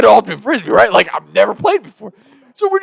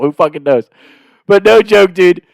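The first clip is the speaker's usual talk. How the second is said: ordinary delivery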